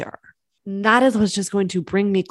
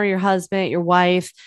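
usual speaking voice

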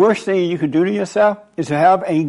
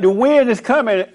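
alarmed warning; 7 semitones higher than usual. Retold or imitated. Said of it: "He like".

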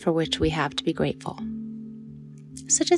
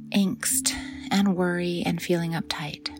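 whisper. Acted. Softly spoken meditation cue.

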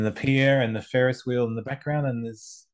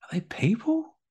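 breathy whispered question; 12 semitones higher than usual. Real, not acted. Co-host squints at the photo, whispering. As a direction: whisper it as if sharing a secret. Peering at tiny figures in photo.

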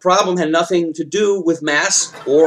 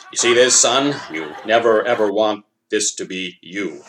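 a hint of a stern father, lower; 6 semitones lower than usual. Father warning his son.

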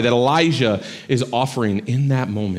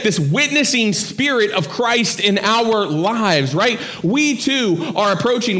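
raised, fervent sermon voice; impassioned preaching; 10 semitones higher than usual. Acted. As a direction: lift the congregation toward the call. Preaching climax at higher pitch.

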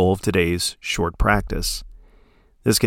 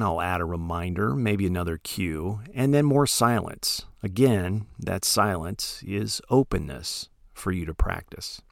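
ordinary talk, nothing marked

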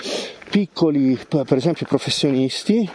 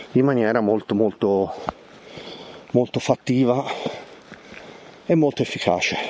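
breathless from walking uphill; panting between words; out of breath; 3 semitones lower than usual. Real, not acted. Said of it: "il fiatone".

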